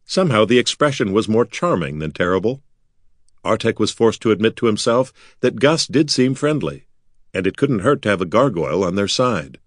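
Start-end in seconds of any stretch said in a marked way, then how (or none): none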